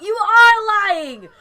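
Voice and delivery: high, loud, slow angry yell; furious accusation. Acted. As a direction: hurl the accusation at her, stretching each word. Acting out an angry character line.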